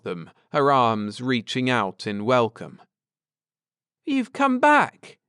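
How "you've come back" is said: put-on very high, louder childlike cry; glad exclamation. A narrator's reading. Girl greeting returning visitors, high glad cry. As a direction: burst out in delight. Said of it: "she exclaimed".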